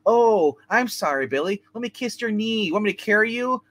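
put-on high, cooing baby talk; mock coddling. Mocking modern parents coddling a child, high coo. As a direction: fuss over him like a doting parent. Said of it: "nowadays we're like".

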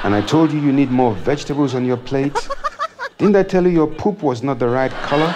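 a hint of a nagging wife; wife's voice. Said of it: "and she said".

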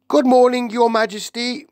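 put-on high, loud cheerful old maid; bright morning greeting from an old servant. A narrator's reading.

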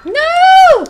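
high, loud cry of dismay; real shout. Real, not acted. Streamer shouting at losing the round, loud dismay. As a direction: yell no as the match slips away.

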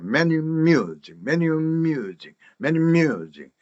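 "many amusing" with the a absorbed into many; linked sounds. Shown as a demonstration. Teacher shows the native way, "many amusing" joined up. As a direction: run "many amusing" together as one word. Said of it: "이어서 빨리 발음을 해요".

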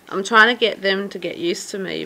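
usual speaking voice

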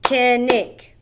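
"Kinnick" in two loud beats, kin, nick; split into syllables. Shown as a demonstration. Teacher doing pupils' names, "Kinnick" beat by beat. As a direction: punch out both halves of "Kinnick". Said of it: "Two syllables".

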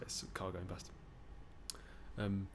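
ordinary delivery

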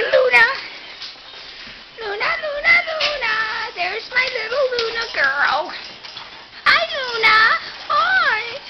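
high pet-talk voice. Real, not acted. Owner calling her dog Luna, high soft pet voice. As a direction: call her sweetly up high.

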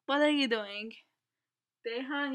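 usual speaking voice

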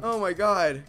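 normal talking voice

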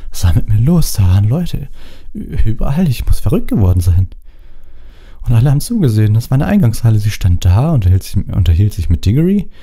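distraught Ron. Acted. Ron voiced in shock.

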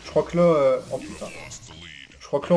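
ordinary delivery

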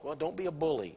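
ordinary talk

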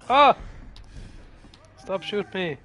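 frantic high yelling; terrified horror victim. Real, not acted. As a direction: yell in panic as you die. Being shot, about to die.

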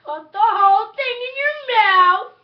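high, loud laughing urge; excited urging. Real, not acted. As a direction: egg her on, laughing. Urging partner to eat.

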